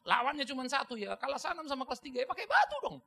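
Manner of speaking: loud, high argumentative outburst; heated protest. Acted. Preacher justifying the rock in his childhood fight, heated protest.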